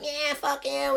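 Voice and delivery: high, whining jeer; mock bitchy girls. Girls being dragged out of the gig, mock jeering.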